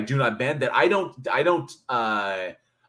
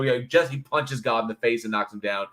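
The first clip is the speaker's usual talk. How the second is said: ordinary talk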